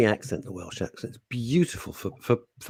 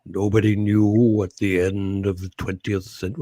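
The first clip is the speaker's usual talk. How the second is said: lower, measured actor's narration; Richard Burton; 4 semitones lower than usual. Acted. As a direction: imitate a solemn Welsh actor narrating. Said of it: "oh yeah, Richard Burton".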